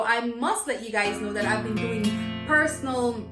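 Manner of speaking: ordinary talk, nothing marked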